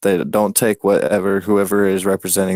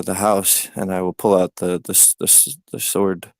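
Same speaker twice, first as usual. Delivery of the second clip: ordinary talk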